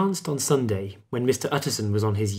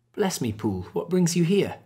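mild exclamation rising into a higher question; surprised cry; 7 semitones higher than usual. A narrator's reading. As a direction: greet an unexpected visitor with surprise. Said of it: "he cried".

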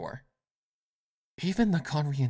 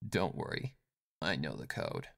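soft, self-assured; quietly sure of herself; confident; 10 semitones lower, 7 dB quieter than usual. Acted. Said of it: "she stated confidently".

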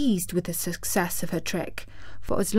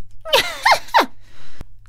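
high witch cackle; shrieking mocking cackle; witch's laugh. A narrator's reading. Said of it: "said the witch, laughing at her".

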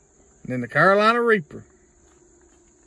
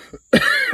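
coughing. Real, not acted. Eating hot jelly bean.

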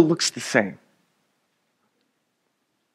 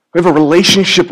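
usual speaking voice

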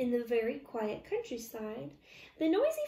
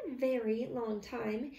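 plain reading voice

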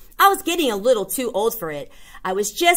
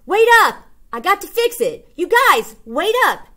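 put-on much higher, loud child call; kid yelling wait up; 10 semitones higher, 4 dB louder than usual. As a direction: shout after friends running ahead. Kid calling to friends.

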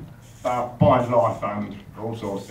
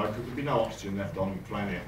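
normal talking voice

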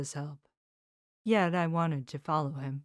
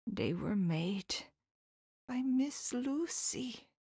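whispered, slow, rising on Lucy; grave reveal; 8 semitones higher, 4 dB quieter, slower than usual. Acted. Professor whispering the truth about Lucy, hushed. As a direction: breathe the terrible truth.

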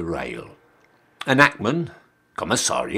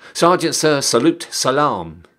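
loud, higher sentry call; called-out salute; 4 semitones higher, 5 dB louder than usual. Acted. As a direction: bark it out like a sentry greeting an officer.

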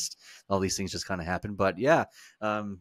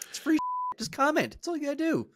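a much higher, squeaky put-on voice; silly high voice; faster than usual. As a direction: beg the fans in a cartoonish high pitch. Pleading fans to comment, silly voice.

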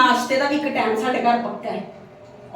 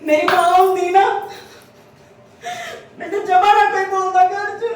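crying; 4 semitones higher than usual. Acted. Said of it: "ਨਹੀਂ ਦੀਦੀ, ਰੋਵੋ ਨਾ".

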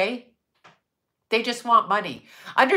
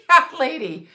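laughing; 7 semitones higher, 4 dB louder than usual. Pretend. Laughing repeat.

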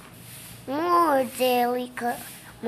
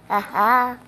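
normal talking voice

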